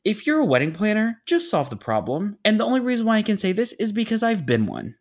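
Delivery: high, emphatic venting; a little heated. Real, not acted. Wedding veteran venting about planners, a little heated. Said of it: "got a little bit heated on the subject".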